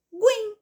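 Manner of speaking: "guim" pitched high and set apart; isolated "guim", high and emphatic; stressed syllable alone. Shown as a demonstration. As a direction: pop out "guim" as the answer. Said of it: "a sílaba mais forte".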